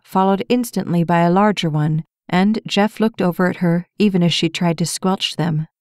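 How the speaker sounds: usual speaking voice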